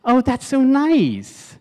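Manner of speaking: very high, drawn-out cooing; hammed-up delighted sweetness; gushing response. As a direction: swoon over it in a high voice. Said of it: "here's how she could have responded".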